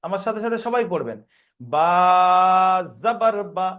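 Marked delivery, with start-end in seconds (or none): 1.6-3.7 first "বা" held a little, last "বা" short; spelling drill with a moderately long opening "বা"; one-alif elongation. Shown as a demonstration. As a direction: draw out the letter name "বা", then stop "জাবার বা" short. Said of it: "এক আলিফ টান দিয়েছি".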